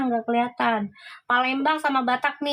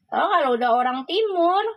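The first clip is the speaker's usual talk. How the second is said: ordinary talk, nothing marked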